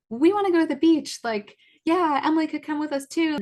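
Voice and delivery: high, loud imitation of eager kids; mock childlike enthusiasm; excited kids. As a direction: pipe up like children pleading for an outing. Children begging for beach trip.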